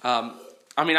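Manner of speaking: ordinary talk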